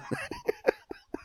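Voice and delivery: squealing high laugh. Real, not acted. High laugh after joke.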